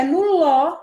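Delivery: "ló" loud, high and held; stress on ló. Shown as a demonstration. Teacher pointing out the tonic syllable, "ló" loud and long. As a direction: push hard on "ló" and stretch it. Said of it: "como eu falo quilômetro".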